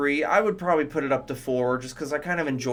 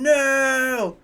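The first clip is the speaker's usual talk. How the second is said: mock cry; 11 semitones higher, 5 dB louder than usual. Narrating toy train fight scene.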